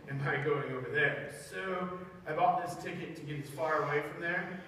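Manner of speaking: quiet, offhand, shrugging; dismissive Jonah. Acted. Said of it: "and I was like".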